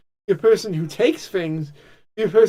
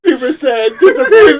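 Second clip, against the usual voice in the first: Trump impression; 6 semitones higher, 7 dB louder than usual. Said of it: "continues Trump voice".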